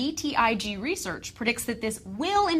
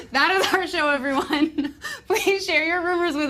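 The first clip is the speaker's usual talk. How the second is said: laughing; 5 semitones higher, 3 dB louder than usual. Real, not acted. Host closes the show after nerd voice, laughing. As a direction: sign off through real laughter.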